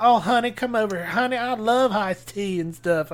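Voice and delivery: imitated Appalachian twang; Southern accent. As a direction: put on a syrupy Southern accent. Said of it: "went back into his accent".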